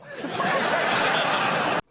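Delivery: wheezing high-pitched laugh; high breathless laugh. Real, not acted. Reactor cracking up at the whiskey joke, wheezing laugh. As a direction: burst into a silent wheeze.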